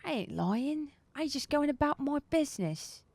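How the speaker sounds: lightly voiced cockney pleading; cockney denial, a bit put-upon; cockney satyr. Pinned satyr denying everything in light cockney. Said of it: "still with this accent".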